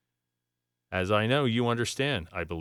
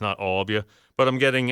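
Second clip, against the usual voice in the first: usual speaking voice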